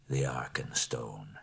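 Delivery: soft, slow, unvoiced whisper; dreamy murmur. A narrator's reading. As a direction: breathe the name like a dream. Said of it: "murmured Thorin in the dark".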